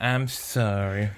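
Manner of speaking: ordinary talk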